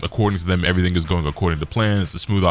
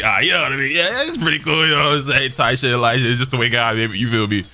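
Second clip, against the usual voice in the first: flattered blush; 4 semitones higher than usual. Retold or imitated. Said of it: "gets a little flattered and starts blushing".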